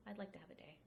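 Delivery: whispered aside. Retold or imitated. Quoting own sarcastic reply.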